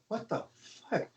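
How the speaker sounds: very quiet, under the breath; hushed mock bewilderment; retold stunned reaction. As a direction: say it to yourself in disbelief, barely voiced. Drummer's stunned inner reaction to stop.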